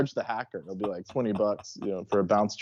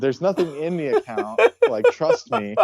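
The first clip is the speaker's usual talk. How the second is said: laughing, pitched up, insisting there's nothing there; laughing insistence; 7 semitones higher, 5 dB louder than usual. Retold or imitated. Mocking the scammer, laughing that the account is empty. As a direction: giggle through it, pleading.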